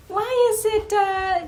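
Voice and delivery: put-on little boy voice. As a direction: voice a curious kid asking Michael. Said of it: "and the little boy said to Michael".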